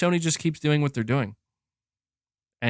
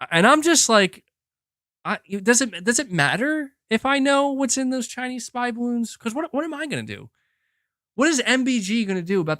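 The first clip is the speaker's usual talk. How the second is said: exasperated; 9 semitones higher than usual. Real, not acted. Exasperated rant about spy balloons.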